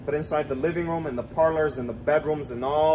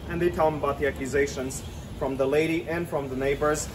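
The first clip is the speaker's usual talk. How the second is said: usual speaking voice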